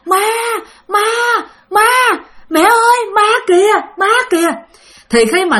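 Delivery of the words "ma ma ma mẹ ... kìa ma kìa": put-on high-pitched, drawn-out wail of a scared child; imitated frightened scream. Five-year-old waking at night screaming about a ghost, imitated frightened scream. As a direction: cry out like a terrified little kid. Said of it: "Nó hét lên, nó nói rằng".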